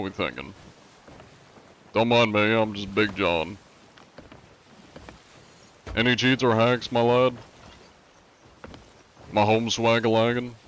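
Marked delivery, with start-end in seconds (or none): 1.9-10.6 lightly put-on prim gentleman, a bit higher; polite gentleman pirate. Streamer hails a rival crew, lightly voiced courteous gentleman pirate. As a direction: sweet-talk the strangers like an overly courteous pirate.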